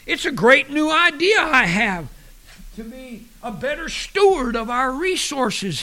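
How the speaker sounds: high, loud, excited husband in a joke; mock gasping husband. Winded husband tells his wife his new idea, mock gasping. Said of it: "he gasped".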